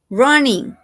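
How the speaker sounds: teacher modelling the -ing form; model word. Shown as a demonstration. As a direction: show the doubled n in "running". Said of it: "Check this".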